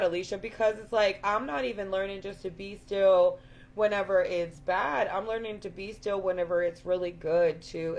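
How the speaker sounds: ordinary talk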